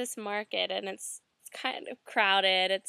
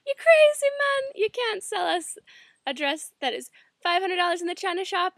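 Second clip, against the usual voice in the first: much higher and louder, indignant; going off in patois; 8 semitones higher, 7 dB louder than usual. Retold or imitated. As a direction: yell at the shopkeeper as a local would. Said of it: "we going off".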